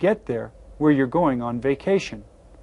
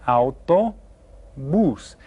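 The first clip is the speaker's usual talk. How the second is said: "autobús" very slow, final "bús" stressed; much slower than usual. Shown as a demonstration. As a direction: drag out "autobús" and land on "bús". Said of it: "you hear how this last syllable is stressed".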